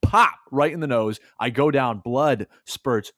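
normal talking voice

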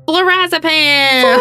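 drawn-out, high sing-out; theatrical reveal. Acted. Host unveils the expected drug to co-host, theatrical. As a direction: stretch the drug name like a big reveal.

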